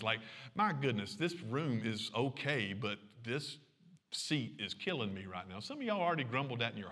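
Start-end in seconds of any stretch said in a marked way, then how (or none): 0.5-5.6 mock grumbling complaint. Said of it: "grumbling or complaining about some things".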